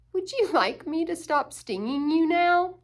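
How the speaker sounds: a lightly voiced buzzing bee; polite bee. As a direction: bee asking the otter kindly. Bee asks the otter.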